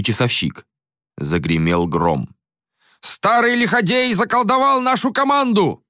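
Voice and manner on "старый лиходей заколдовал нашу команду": high furious yell. A narrator's reading. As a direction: bellow it at the crowd. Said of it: "орал Боре".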